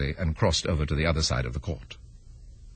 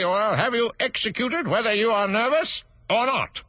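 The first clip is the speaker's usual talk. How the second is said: high, louder threatening; angry; 14 semitones higher, 4 dB louder than usual. Acted. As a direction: threaten him crossly. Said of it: "the king repeated angrily".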